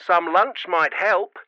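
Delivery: mum through a crackly walkie-talkie; easy denial over radio static; scratchy radio voice. A narrator's reading. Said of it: "the scratchy voice".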